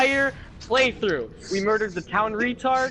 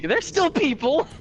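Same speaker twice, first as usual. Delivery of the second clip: indignant shout; 7 semitones higher than usual. Real, not acted. Player protesting the orc killing.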